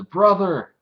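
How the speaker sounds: high, loud cry for his brother; frightened child calling. Retold or imitated. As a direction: call out to your brother in fear. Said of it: "little Jin says".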